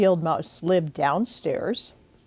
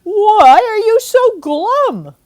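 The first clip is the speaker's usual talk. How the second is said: high, loud, slow aunt voice; a big cheery aunt calling out; Aunt Mim's voice; 14 semitones higher, 11 dB louder, slower than usual. Acted. Aunt asking the children why they're glum, high and loud.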